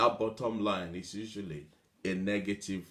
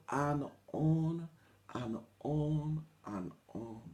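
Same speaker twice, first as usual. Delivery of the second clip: fading drawl; 3 semitones higher, 6 dB quieter, slower than usual. Shown as a demonstration. Drawn-out fading repetition.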